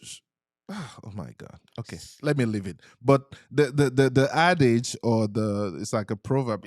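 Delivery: usual speaking voice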